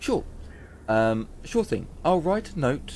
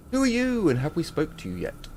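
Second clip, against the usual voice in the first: character voice; 7 semitones higher than usual. Acted. NPC greeting player.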